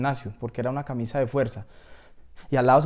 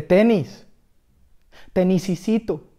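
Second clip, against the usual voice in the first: high, raised call for help; acted higher-pitched appeal; pleading call; 5 semitones higher, 4 dB louder, slower than usual. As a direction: coax the little one from afar. Pleading to small shoe for help.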